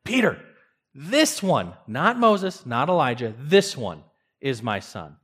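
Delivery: sharp rebuke, each phrase stressed; harsher. Shown as a demonstration. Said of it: "speaking a little harsher to Peter".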